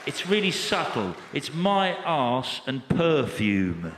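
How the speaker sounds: slow, lightly voiced perfume-ad delivery; Keira Knightley. Film star selling perfume, lightly voiced slow advert. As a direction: draw out a mock perfume pitch. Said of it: "it's Keira Knightley here".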